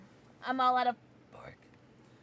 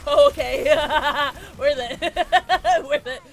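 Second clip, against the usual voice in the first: loud words through real laughter; laughing hard; 3 semitones higher, 13 dB louder than usual. Real, not acted. Gamer deciding it was worth it, loud laughter. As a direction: burst out laughing between the words. Laughing over a game moment.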